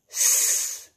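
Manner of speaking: S as a long voiceless hiss; phonics sound demo. Shown as a demonstration. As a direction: hold the sound of "S" as pure sss. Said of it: "first sound you can hear".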